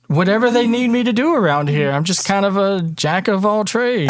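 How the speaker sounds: high, quick, eager character voice; innocent newcomer. Acted. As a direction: chirp it modestly, pitched well up. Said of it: "Jeff's a little innocent boy".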